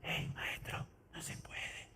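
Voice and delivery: susurro apenas audible, sin voz; no exclamado. Shown as a demonstration. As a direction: whisper it meekly, as a weak objection. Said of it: "No fue que le dijo". (susurrando tímidamente, sin exclamar)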